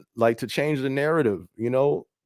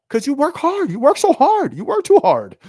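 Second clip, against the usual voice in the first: higher, lightly voiced earnest stranger; earnest acquaintance; 7 dB louder than usual. Stranger praising his hard work, lightly mimicked. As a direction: play the stranger sincerely explaining. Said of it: "They're like".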